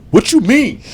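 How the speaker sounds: high-pitched mock indignation; defensive retort. Boyfriend answering her attitude, high-pitched defensive retort. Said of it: "whenever she give me attitude".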